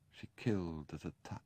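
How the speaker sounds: breathy hushed whisper, almost secret. Acted. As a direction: whisper it as a dark confession. Sebastian recalls his mother, hushed.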